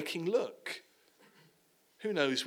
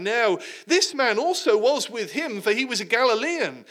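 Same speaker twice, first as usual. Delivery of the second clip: higher, louder, insistent charge; loud accusation; 6 semitones higher, 6 dB louder than usual. Acted. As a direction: nail him as a Galilean. Third accuser in courtyard.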